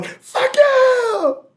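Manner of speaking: high, loud mock scream. Mimicking him screaming at her by email, high mock yell. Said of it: "he screams at her via email".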